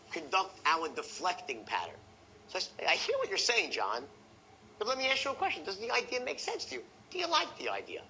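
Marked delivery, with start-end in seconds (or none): 2.9-8.0 light, rising and much higher, casual and hypothetical; money aside tonality. Shown as a demonstration. Salesman to prospect John, airy high hypothetical question. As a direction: float the question up high as if money doesn't matter. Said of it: "That's my hypothetical money aside tonality".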